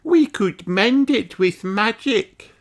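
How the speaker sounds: high, slow little fairy voice; fairy girl voice. A narrator's reading. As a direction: suggest it sweetly like a small fairy. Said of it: "suggests Strawberry".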